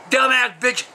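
ordinary talk, nothing marked

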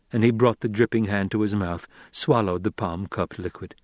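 ordinary delivery

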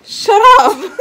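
loud laughing yell; laughing shout. Real, not acted.

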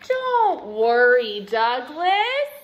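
loud, slow, rising on the name; reassuring dad. A narrator's reading. Dad reassuring Douglas after hearing everything, slow hearty voice. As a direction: stretch it out, lift the ending. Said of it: "said Dad".

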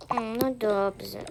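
usual speaking voice

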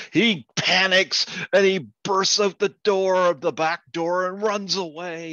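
loud, high burst of narration; panicked storytelling. Acted. Joke teller describing a frantic escape, loud excited burst. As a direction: raise pitch and volume for the panic.

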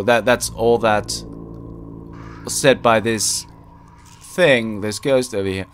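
ordinary talk

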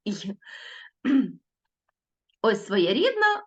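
ordinary talk, nothing marked

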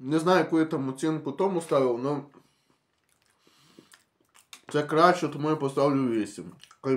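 talking between bites of biscuit; chewing while talking. Real, not acted. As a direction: mouth partly full. Seen 0: Holds bitten biscuit; lips move as if chewing between words.

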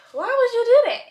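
high, loud, fed-up question; exasperated. Real, not acted. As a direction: ask it in disbelief. Puppy peed all over house.

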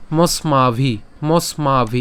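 মস্মাভি with a crisp dental s; drilled pronunciation. Shown as a demonstration. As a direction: repeat মস্মাভি clearly. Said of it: "দন্তাস পর্যন্ত আমরা উচ্চারণ করছি". (মস্মাভি স্পষ্ট দন্ত্য স দিয়ে)

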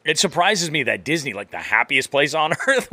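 ordinary talk

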